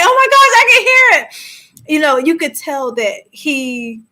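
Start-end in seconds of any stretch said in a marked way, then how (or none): 0.0-1.9 much higher and louder, thrilled; excited squeal. Retold or imitated. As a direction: gush with delight at the discovery. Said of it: "I was like".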